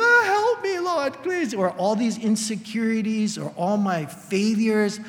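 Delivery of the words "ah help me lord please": desperate cry for help. Retold or imitated. A man in the night crying out to God for help, high desperate plea. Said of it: "in the middle of the night screaming".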